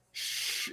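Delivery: soft, breathy shushing sound. Retold or imitated. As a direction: hush someone gently with a long "shh". Acts out the shush again.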